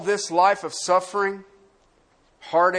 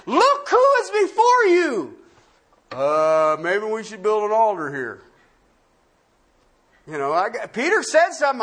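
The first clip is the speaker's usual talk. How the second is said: high, loud, fervent preaching; impassioned pulpit voice; preaching at full force; 10 semitones higher, 6 dB louder than usual. Acted. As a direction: drive it home to the congregation.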